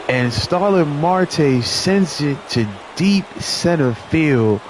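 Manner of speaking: high, drawn-out play-by-play call; excited home-run call. Acted. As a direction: call the game-tying homer, stretching out key words.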